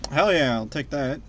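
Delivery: ordinary delivery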